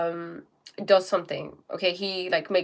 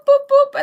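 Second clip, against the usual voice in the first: high, loud button boops; sharp, high-pitched booping; button noise; 11 dB louder than usual. Acted. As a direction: imitate an alarm button going off. Imitates a button being pressed repeatedly.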